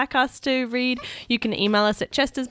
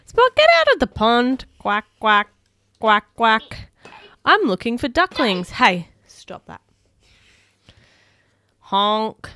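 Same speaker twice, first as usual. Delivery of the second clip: animated picture-book reading; reading to children; 5 dB louder, slower than usual. Acted. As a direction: read it like bedtime for little ones. Reads picture book aloud.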